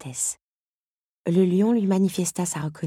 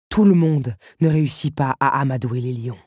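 louder, menacing warning; 7 dB louder than usual. Acted. The beast warns Belle about taming lions, menacing. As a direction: let the menace sit under the words. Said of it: "prévint-il".

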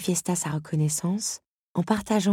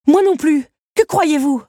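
much higher, much louder outraged cry; indignant; 13 semitones higher, 12 dB louder than usual. Acted. Belle retorts to the beast, indignant. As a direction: explode with indignation. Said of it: "s'indigna Belle".

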